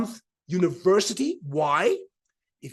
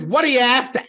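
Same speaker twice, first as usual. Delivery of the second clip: loud broad Aussie demand, pitched up; broad Australian accent; 4 semitones higher, 7 dB louder than usual. Retold or imitated. Bogan waiter repeats the question, broad Australian accent. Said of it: "in this very broad Australian accent".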